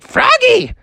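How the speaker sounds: very high, bubbly mother; a mom thrilled by a dance class; squeaky high excited mom. Acted. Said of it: "Froggy's mom woke up and was like".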